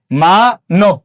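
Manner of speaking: মা-নব as maa, then nob; split pronunciation. Shown as a demonstration. Teacher repeats the model for students, split pronunciation. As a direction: say মা-নব in two parts, o in the second. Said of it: "মানবটা উচ্চারণে কি হবে?".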